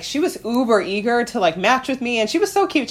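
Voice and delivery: ordinary talk, nothing marked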